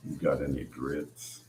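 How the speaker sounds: put-on low, deep, rumbling teen voice. Teen son asking mom for grits in a new deep voice. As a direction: drop it low like a voice just broken. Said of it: "my voice changed".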